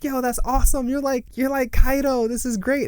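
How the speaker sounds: hyped crowd. Retold or imitated. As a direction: cheer him on like fans. Crowd praising Momonosuke.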